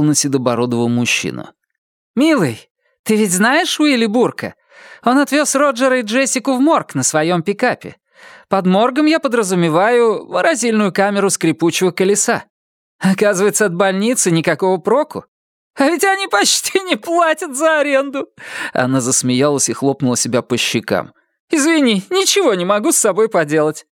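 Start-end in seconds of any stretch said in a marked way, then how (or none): 2.1-18.3 high, lively voice of a giddy wife; excited wife voice. Acted. Lila voiced by narrator. 21.5-23.9 high, lively voice of a giddy wife; excited wife voice. Acted. Lila voiced by narrator.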